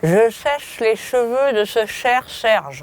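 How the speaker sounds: diction drill with a pen in mouth; pen in teeth. Shown as a demonstration. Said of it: "avec un stylo dans la bouche".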